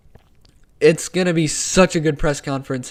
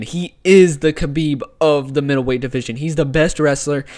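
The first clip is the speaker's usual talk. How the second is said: ordinary delivery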